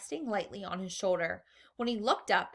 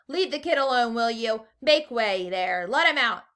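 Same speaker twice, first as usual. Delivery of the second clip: loud, commanding shopkeeper; firm loud orders; 3 semitones higher, 8 dB louder than usual. A narrator's reading. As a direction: push the crowd back firmly. Shopkeeper clearing the crowd.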